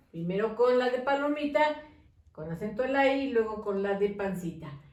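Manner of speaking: normal talking voice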